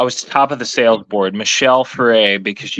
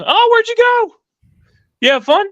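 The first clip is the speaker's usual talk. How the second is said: much higher mock boss voice; imitating the boss; 4 dB louder than usual. Salesman imitating his general sales manager, high mock voice. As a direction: play the boss as a squeaky, amused pushover. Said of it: "And he goes".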